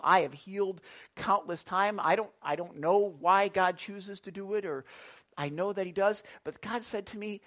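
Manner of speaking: normal talking voice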